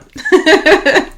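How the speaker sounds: loud high laugh. Real, not acted. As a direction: burst out laughing at the joke. Laugh at own joke.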